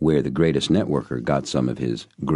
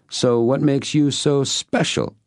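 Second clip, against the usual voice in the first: high, slow teasing woman; lightly voiced curious woman; woman's voice; 13 semitones higher, slower than usual. A narrator's reading. Female character voice.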